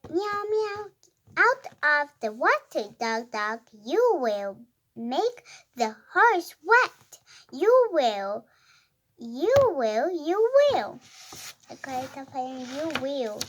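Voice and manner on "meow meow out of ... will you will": animated story reading. Acted. Reader voicing a quarrelling cat and dog, animated read-aloud. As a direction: read it out clearly, pitch jumping up on woofs and meows. Resumes reading the cat's part.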